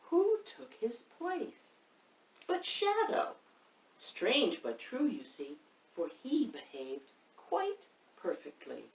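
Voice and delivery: lively picture-book reading; reading to children. A narrator's reading. Reader performs the rhyming book for children. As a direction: read it to the kids with bounce.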